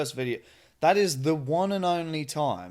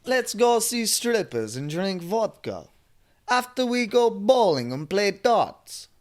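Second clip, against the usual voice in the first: put-on higher, louder mixed accent; Russian-Boston mix accent; 8 semitones higher, 5 dB louder than usual. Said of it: "a mix of, like, a Boston".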